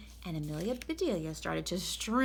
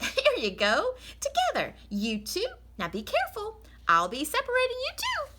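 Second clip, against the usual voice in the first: much higher, playful and loud; Amelia Bedelia; 5 dB louder than usual. Acted. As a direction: coax the eggs apart like little kids. Amelia talks to the eggs.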